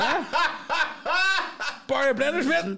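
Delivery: high, loud mock shout; hammed-up yell across a crowd; calling out. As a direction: hail a fellow countryman abroad. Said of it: "I hear our accent, I do wave".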